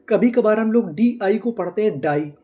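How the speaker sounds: ordinary talk, nothing marked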